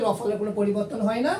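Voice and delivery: ordinary talk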